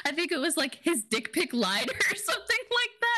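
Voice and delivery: high voice bubbling with real laughter; giggling through the words, pitched high; amused laughing. Real, not acted. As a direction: say it while cracking up. Amused recalling an outrageous line.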